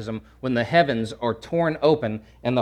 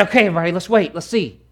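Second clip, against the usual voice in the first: slightly raised, hurried hushing; acted bystander calling for patience; shushing the crowd; 5 semitones higher, 3 dB louder, faster than usual. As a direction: settle everyone down to watch. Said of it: "and then shushes everybody".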